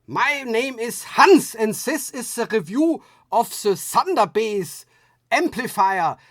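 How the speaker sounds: playful mock German accent introducing the review; Hans German accent. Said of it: "should say it with a German accent".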